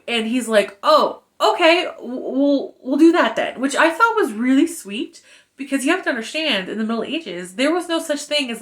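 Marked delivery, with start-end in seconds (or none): 0.8-3.6 a light, hesitant, higher mock of Hawk; a hint of a flustered knight; bashful Hawk. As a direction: stammer shyly as the smitten hero. Voicing Hawk's bashful reply.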